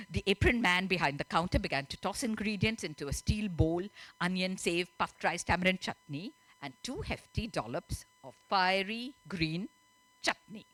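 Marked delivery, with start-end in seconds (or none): none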